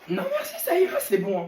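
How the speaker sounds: laughing, mocking refusal. Student mocking the teacher's offer, laughing.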